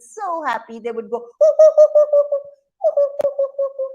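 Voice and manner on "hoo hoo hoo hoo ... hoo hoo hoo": very high, loud hoo-hoos; excited monkey hoots. Acted. Baby monkeys so happy about the house, high hooting. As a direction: hoot like thrilled baby monkeys. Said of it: "baby monkeys would be so excited".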